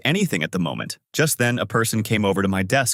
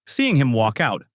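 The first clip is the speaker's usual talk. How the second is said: usual speaking voice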